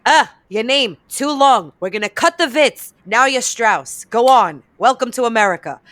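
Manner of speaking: loud, brusque mock official; Ellis Island official. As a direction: imitate an official loudly dismissing a newcomer. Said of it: "some guy at Ellis Island was like".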